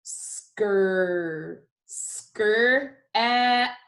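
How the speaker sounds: "Skr, skr, app" in slow separate chunks; sounding out. Shown as a demonstration. Teacher sounding out with the class, slowly in pieces. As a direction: stretch the blend "skr", then add "app". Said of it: "let's sound it out together".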